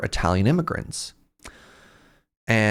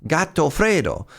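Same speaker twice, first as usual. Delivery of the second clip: higher-pitched mock Italian speaker; imitated Italian; 6 semitones higher than usual. Italian man explaining to his English teacher, in imitated Italian. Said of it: "probably not getting the Italian right".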